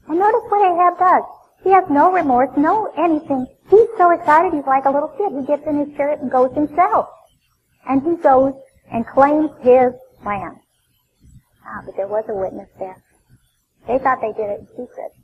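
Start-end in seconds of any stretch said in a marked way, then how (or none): 0.1-10.6 louder, higher preaching build; fervent sermon delivery. Acted. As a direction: drive the story home from the pulpit. Preaching at full force.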